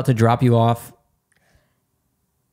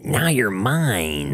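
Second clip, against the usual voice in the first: villain voice; 3 dB quieter, much slower than usual. Pretend. Taking over the mic.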